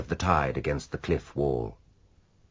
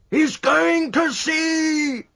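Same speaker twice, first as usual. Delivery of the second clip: high, loud, slow outraged shout; 4 dB louder, much slower than usual. A narrator's reading. Rashleigh shouting as the ship makes for sea. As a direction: bawl it in disbelief. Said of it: "shouted Rashleigh".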